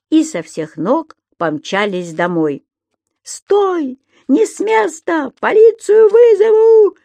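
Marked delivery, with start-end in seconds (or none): 3.2-7.0 high, loud furious yelling. A narrator's reading. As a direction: scream after them. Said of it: "вопил им вслед господин Жабман".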